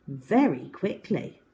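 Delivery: ordinary delivery, nothing marked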